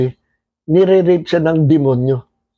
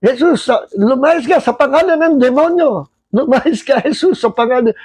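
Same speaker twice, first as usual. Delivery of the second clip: flustered father; 7 semitones higher than usual. Retold or imitated. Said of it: "nataranta po si tatay".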